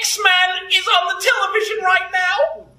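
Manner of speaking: high, hammed-up American voice; high-pitched American character; put-on American accent. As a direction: announce it in a squeaky American accent. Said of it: "Did you like my American accent?".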